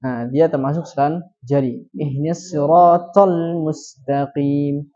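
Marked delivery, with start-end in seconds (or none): 1.9-4.9 "siratal" dengan to tebal, lambat; to tebal dan ditekan. Shown as a demonstration. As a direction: contoh benar huruf to. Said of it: "Jadi harus syiddah ya, to". (bacaan benar to tebal)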